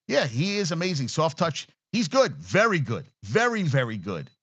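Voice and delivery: ordinary delivery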